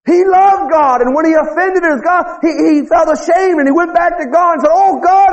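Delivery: high-pitched, driving revival preacher; full-force preaching. Real, not acted.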